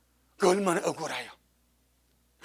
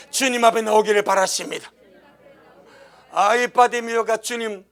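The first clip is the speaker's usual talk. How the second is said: ordinary talk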